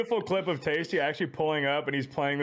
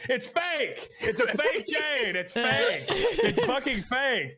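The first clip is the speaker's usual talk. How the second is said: high, slow, laughing insistence that it's fake; laughing panicked denial; 9 semitones higher, much slower than usual. Telling friends how he denied the chain, laughing high and slow. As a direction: blurt it out through laughter, way up high. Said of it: "I just immediately go".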